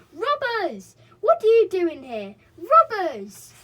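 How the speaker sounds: high, loud accusing yells; angry gnome shouts. A narrator's reading. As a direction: shout down intruders.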